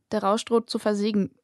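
usual speaking voice

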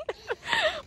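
high breathless relieved laugh; shaky laugh. Real, not acted. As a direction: laugh off the fright. Laughing after the scare.

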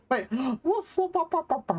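high, yappy woofs with a gasp and a laugh; mock dog barking. Streamer answers the game dog with mock woofs, high and laughing. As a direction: bark back at the dog like a playful puppy.

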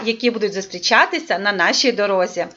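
usual speaking voice

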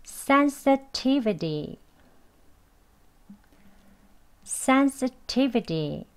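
"sensitivity" slow, final t softened to a d; flapped t. Shown as a demonstration. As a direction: say "sensitivity" ending "-bidi", slowly. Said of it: "D로 발음하겠습니다".

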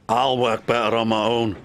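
low, aloof Scottish grumble; sniffy. A narrator's reading. Douglas snubs his twin, sniffy and low. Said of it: "sniffed Douglas".

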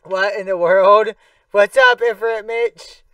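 loud, delighted hello; excited greeting. Real, not acted. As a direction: greet a friend with a shout.

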